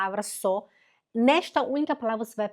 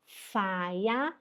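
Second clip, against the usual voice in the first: Feierabend very slow, softer, rising at the end; slow model word; 2 semitones lower, 5 dB quieter, much slower than usual. Shown as a demonstration. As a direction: draw out Feierabend gently. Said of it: "Primeiro é Feier, depois é Abend". (Feierabend bem devagar e suave)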